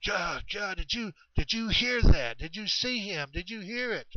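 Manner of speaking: high-pitched breathless-with-news questioning; excited. Retold or imitated. Excited DJ asking Chet about the creature, high and eager. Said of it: "D J's all excited".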